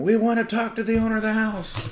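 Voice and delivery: very high, loud imitated scream; full-volume, high-pitched cop shout. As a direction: yell it like cops demanding an answer. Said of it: "the cops are screaming".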